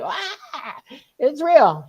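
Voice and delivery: high, breaking yelp of relief with a laugh; excited scream. Retold or imitated. Teenage girl shrieking to her mother that it's real. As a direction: shriek it out like proof just landed. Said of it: "I start screaming".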